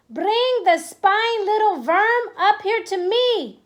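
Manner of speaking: high, loud, slow strange witch voice; shouting witch. Acted. Grand High Witch demanding the boy be carried up, loud witch shout. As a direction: snarl it like a wicked witch. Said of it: "shouted the Grand High Witch".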